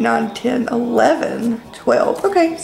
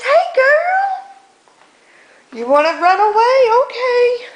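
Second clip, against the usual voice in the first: pet talk; 13 semitones higher than usual. Real, not acted. Greeting another pet.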